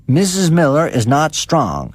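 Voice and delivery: lightly voiced severe young doctor. A narrator's reading. Doctor warning the neighbour, mildly severe. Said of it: "says he, kinda severe".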